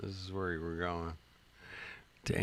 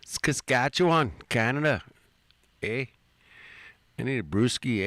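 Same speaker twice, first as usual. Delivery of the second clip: loud hammed-up Canadian accent, eh; a mock Canuck from the movies; 3 semitones higher, 6 dB louder than usual. Streamer doing a loud mock Canadian voice. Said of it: "that's where I learned how to talk like Canadian".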